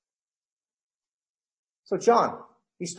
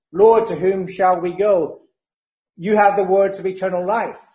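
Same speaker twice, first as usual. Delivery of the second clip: scripture reading; 3 semitones higher, 5 dB louder than usual. Acted. Peter's answer read out.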